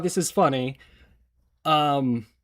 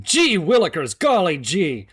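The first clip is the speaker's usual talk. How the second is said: put-on loud, chipper old-timey gee-whiz voice; hammed-up golly-gee enthusiasm; 1950s American; 7 semitones higher, 6 dB louder than usual. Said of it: "talking like a nineteen fifties American".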